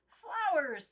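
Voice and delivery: high, loud delighted cry; the doll thrilled by the idea; exclaiming. Acted. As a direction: shout it out with joy. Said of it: "Timothy John exclaimed".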